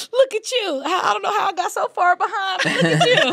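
high, laughing admiration; playful excited quote; mock amazed. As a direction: gush over how far he's come. Retold praise of old friend.

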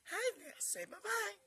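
high, loud singsong coaxing; puppy talk. Real, not acted. Owner asking puppy to say bye-bye, high puppy talk.

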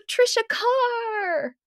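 very high, slow, drawn-out squeal of the name; gushing recognition. Retold or imitated. Close friend Lisa squeals her full name in mock excitement. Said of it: "she'd go".

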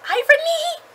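very high, loud exclamation; gleeful shout. Real, not acted. Reader shouts to viewers about the book's irony, high and loud. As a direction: call it out like catching a plot twist.

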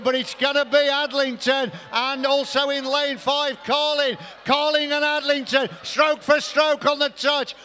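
high-pitched, shouted race climax; excited finish call. Real, not acted. Commentator calling Adlington to gold at the touch, excited finish call. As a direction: shout them home stroke for stroke.

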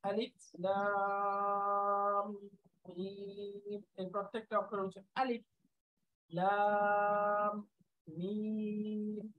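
each letter drawn out very slowly; carefully, letter by letter, very slowly. Shown as a demonstration. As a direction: stretch every letter name. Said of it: "একদম খেয়াল করে করে".

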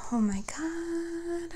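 high, soft, slow whispered Oh my God; real stunned whisper at the card; awed whisper. Real, not acted. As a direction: breathe it out in wonder. Awed reaction to drawn card.

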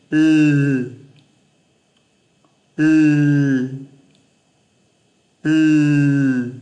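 tongue tip on upper teeth, air past the sides; held English L. Shown as a demonstration. Teacher demonstrating the English L sound. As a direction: hold the tongue and let air slide out. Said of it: "Giữ tại đấy, đẩy hơi ra này".

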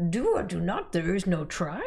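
light Yoda impression; croaky little Jedi master. Yoda quote for catboy Yoda drawing.

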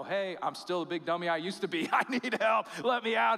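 loud and high, laughing at himself; a sheepish child laughing for help; laughing plea. Pretend. Returning to God, a loud laughing plea for help. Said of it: "come back home and go".

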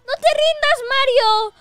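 high-pitched pleading cheer; urgent encouragement. Acted. Urging Mario not to give up, high urgent cry. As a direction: urge him on in a high voice.

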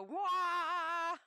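high, loud mock monster roar; hammed-up yeti bellow. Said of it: "'Cause it used to go".

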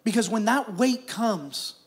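usual speaking voice

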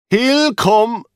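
a lightly voiced guard, confident. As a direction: reassure him it's fine.

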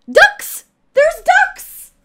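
very high, very loud shriek; excited shriek. Real, not acted. Streamer spotting ducks in the game, excited shriek. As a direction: shriek it out in sheer delight.

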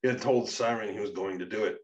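plain reading voice

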